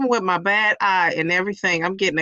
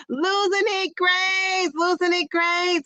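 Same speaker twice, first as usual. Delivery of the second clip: high, slow, drawn-out name; excited name call; 7 semitones higher, slower than usual. Real, not acted. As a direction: savour the funny name. Calling out viewer's name.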